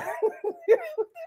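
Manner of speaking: short high laugh; quick high-pitched laugh; high giggle. Real, not acted. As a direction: let a giggle slip out. Laughing at testimony.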